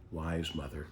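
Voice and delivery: ordinary delivery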